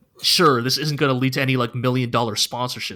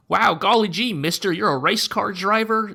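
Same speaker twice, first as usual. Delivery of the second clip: put-on higher gee-whiz kid voice; eager small-town kid, all wonder; wholesome 1950s kid; 6 semitones higher than usual. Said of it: "a precocious neighborhood child".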